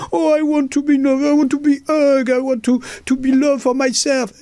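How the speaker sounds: high, fast, whiny pleading; mock whiny lovesick voice. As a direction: whine like a lovesick dreamer. Mocking people craving love.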